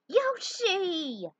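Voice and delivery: high, loud call across a clearing. Acted. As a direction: shout his name to catch his attention. Luigi calls to Yoshi.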